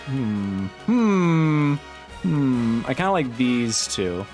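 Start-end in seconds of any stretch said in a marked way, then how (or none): none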